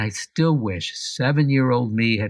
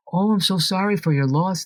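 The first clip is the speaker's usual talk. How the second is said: mock pity; 9 semitones higher than usual. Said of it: "they say".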